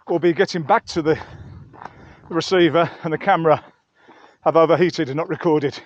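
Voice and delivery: ordinary talk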